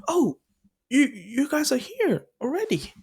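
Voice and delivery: high-pitched stammered surprise, imitated; acted-out astonished greeting; surprised arrivals. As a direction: exclaim high, stumbling on the first words. Arrivals surprised to find them.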